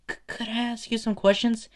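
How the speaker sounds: high-pitched, hesitant eager question; starstruck stammer. Acted. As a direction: ask your idol nervously. Nervous fan asks his idol.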